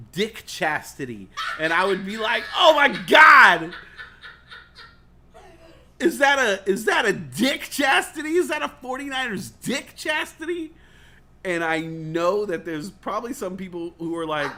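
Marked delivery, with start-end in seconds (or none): 2.6-10.7 loud, high squeal of delight; excited shout. Retold or imitated. Imitating his own thrilled reaction to a gift. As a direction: gasp it out like the best gift ever.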